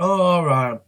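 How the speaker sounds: a sudden loud, high-pitched "oh all right" from a TV patient; mock drowsy patient. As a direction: ham up the patient giving in as the mask goes on. TV patient going under, retold.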